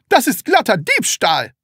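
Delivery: high, loud outraged shout. A narrator's reading. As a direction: yell it in outrage. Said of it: "schrie er".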